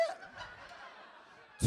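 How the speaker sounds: squeaky unvoiced falsetto yeah, rising; two octaves up. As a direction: go way up into a breathy squeak. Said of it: "he goes".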